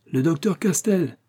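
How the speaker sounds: a carpenter lighting up at the doctor's name; exclaiming. Acted. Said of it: "s'écria-t-il".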